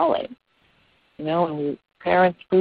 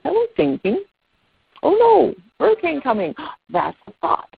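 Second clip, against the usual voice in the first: high-pitched acted "oh no", then a gasp; mock alarm; 12 semitones higher than usual. Teacher showing children how to notice negative thoughts, high mock alarm. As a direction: play catching a worried thought, very high, dropping at the end. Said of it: "negative thoughts. You notice".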